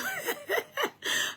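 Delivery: high, soft giggle; laugh. Real, not acted. Streamer amused at why she is singing, laughing. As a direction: giggle lightly at yourself.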